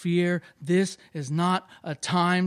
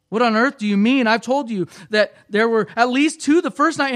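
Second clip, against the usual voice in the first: loud, higher-pitched indignant protest; incredulous boy; 7 semitones higher, 11 dB louder than usual. A narrator's reading. Boy disputes the lion count with the voice, incredulous. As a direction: argue back, voice raised high.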